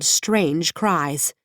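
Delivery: plain reading voice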